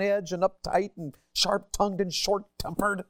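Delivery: a hot, short-tempered outburst; angry frustrated rant. Acted. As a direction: preach the bitterness out. Preaching the angry life.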